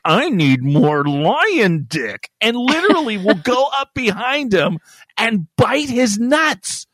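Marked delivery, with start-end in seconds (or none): 0.0-2.3 slow, low, lusty lioness. Pretend. Lioness demanding more from the lion, slow and low. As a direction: drag it out, low and hungry. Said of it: "the female lion literally is like".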